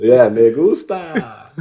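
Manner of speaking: slow, drawn-out me gusta; mock savoring. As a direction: savor the words like a joke. Echoing friend's joke.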